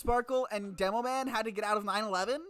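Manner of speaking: loud, high, excited question; excited outburst. Real, not acted. Listener interrupts the reading with a connection, loud and excited.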